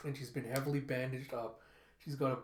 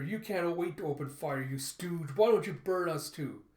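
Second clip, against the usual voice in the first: higher, heated protest; raised-voice challenge; angry taunt; 3 semitones higher, 5 dB louder than usual. Acted. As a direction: yell back defiantly, building louder. Protester yells back at guard.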